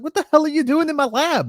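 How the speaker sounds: mock indignant old-man yelp; Grandpa's outburst. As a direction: squawk it like a startled old vampire. Voicing Grandpa startling Herman.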